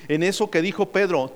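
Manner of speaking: ordinary delivery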